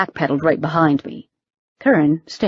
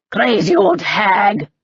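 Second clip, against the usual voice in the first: Russian-tinged insult; 3 semitones higher, slower than usual. Acted. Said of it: "Grigori slipped into Russian".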